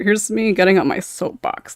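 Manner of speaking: normal talking voice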